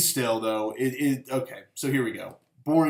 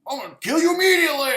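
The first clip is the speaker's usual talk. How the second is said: high, loud and fast furious yell; angry cosmic villain, yelled fast and high; 7 dB louder, much faster than usual. Retold or imitated. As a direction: spit it out at top speed in a rage. Villain kills hero again.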